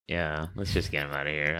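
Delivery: low, soft, resigned reply; weary agreement. Retold or imitated. Medic answers the captain about the flight, wearily resigned. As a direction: murmur it low, wanting it over.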